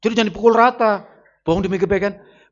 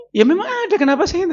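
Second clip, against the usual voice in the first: much higher, lightly acted husband's retort; mock defiant husband; 11 semitones higher than usual. Husband answering his angry wife about prettier women, mock defiant. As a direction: answer back in a high, mock-defensive voice.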